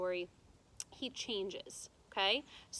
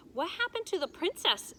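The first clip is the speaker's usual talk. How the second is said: lightly voiced little girl, higher; eager child voice; 8 semitones higher, 5 dB louder than usual. A narrator's reading. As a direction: ask like an impatient young girl. Child Abilene asks eagerly.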